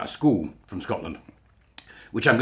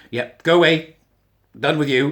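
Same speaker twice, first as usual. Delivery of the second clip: louder, higher, mock send-off; curt dismissal; 5 semitones higher, 7 dB louder than usual. Vlogger sending off those he disagrees with, dismissive. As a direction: wave them away, raised voice.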